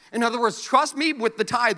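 ordinary talk

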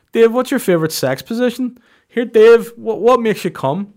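squeaky creepy fan impression; mock creepy listener asking sex questions; weird listener.

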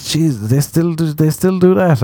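high-pitched Irish disbelief; Irish accent. Retold or imitated. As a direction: retell his shock in an Irish accent. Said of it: "I'm doing an Irish accent".

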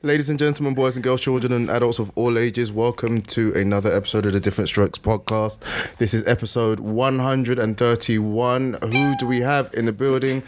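big showman welcome; ringmaster. Acted. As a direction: roll it out like a ringmaster. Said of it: "You've got a radio voice".

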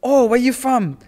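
high, loud, gushing question; imitated curious girl. Random Thai girl asking where the speaker is from, mock-eager.

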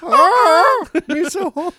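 high moaning woman voice; woman in passion. Retold or imitated. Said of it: "a person getting ran over slowly by a bicycle".

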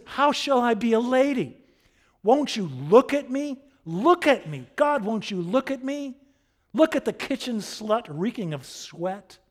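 high, very loud, pleading protest; theatrical cry of exasperated self-loathing; anguished outburst. Acted. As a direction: demand to be seen for what you are. Aldonza's acted anguished outburst to Quixote.

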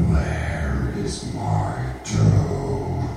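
very low, slow croak; croaking ghost voice. A narrator's reading. Ghost at the boy's door, deep slow croak. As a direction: croak from deep in the throat, slowly. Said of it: "the voice croaked".

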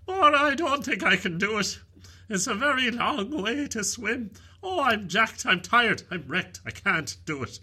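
high, loud whiny horse voice; whining tired horse. Acted. Horse begging Maura not to swim back, high loud whine. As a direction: plead like a worn-out horse. Talking horse complains, exhausted.

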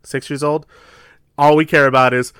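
normal talking voice

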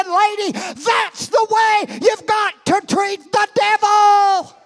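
high, loud, slow shouted declaration; preacher shouting. Retold or imitated. Smith calling to the lady, shouting. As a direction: shout it as a rebuke to the devil. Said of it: "He said".

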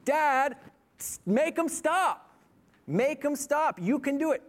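high, imploring appeal to a father; begging child. Retold or imitated. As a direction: plead urgently as a helpless son would. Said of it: "almost begging me, saying".

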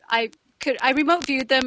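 ordinary delivery

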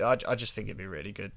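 ordinary talk, nothing marked

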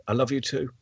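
put-on boy says "I love you too" barely aloud; under his breath. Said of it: "just saying under his, his breath".

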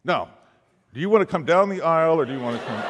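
fast, laughing punchline; laughing through it. Retold or imitated. Pastor finishing his joke to the couple, laughing. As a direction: laugh as you land the joke.

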